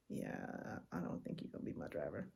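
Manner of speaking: low, soft, fast dismissal; deadpan brush-off. Retold or imitated. Tourist mutters dismissal to upset driver, low and dry.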